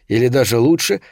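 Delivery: ordinary delivery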